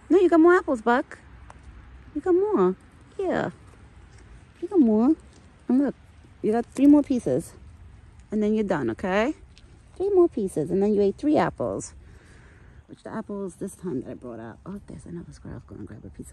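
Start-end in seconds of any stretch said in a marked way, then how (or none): 0.1-11.9 high, loud coaxing pet voice; sing-song scolding of a greedy pet; pet talk to an animal. Real, not acted. Owner coaxing the deer to take fewer treats, high loud pet voice.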